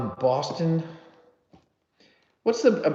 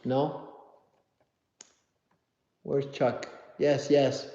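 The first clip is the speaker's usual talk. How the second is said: ordinary talk, nothing marked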